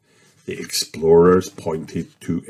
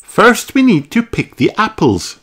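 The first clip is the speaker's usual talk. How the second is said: high, louder cheerful mum voice; Mummy Pig greeting; 14 semitones higher, 3 dB louder than usual. A narrator's reading. Mummy Pig greets George, high and louder. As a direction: greet the little boy as his mother. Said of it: "says Mummy Pig".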